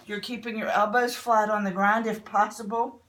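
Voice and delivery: ordinary talk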